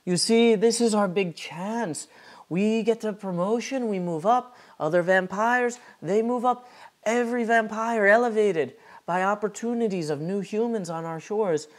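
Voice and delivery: high, urgent plea in character; Sammy's in-character voice. Acted. Sammy pitching the big chance to Marta, dramatic in-character voice. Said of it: "as Sammy turns to Marta and says".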